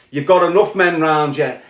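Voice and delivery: ordinary talk, nothing marked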